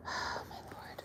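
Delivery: soft whisper under breath; exasperated whisper; whispered aside. Real, not acted. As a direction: sigh it out to herself.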